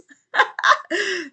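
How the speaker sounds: high burst of laughter; gleeful cackle. Real, not acted. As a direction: cackle at the beaten mob. Laughing at the mob.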